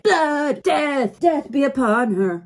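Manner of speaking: high, loud furious proclamation; raging emperor. Retold or imitated. Emperor condemning Naveni's planet, loud and furious. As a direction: bellow the death sentence in a rage. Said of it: "the Emperor's like".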